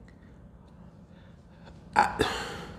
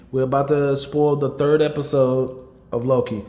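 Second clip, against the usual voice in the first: ordinary talk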